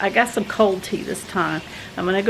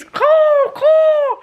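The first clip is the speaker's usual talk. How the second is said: high loud tropical bird calls; bird call imitation; 6 dB louder than usual. Describing the new alarm to viewers, high loud bird calls. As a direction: squawk like rainforest birds. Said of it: "in the rainforest".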